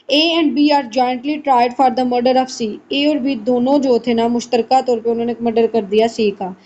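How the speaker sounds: normal talking voice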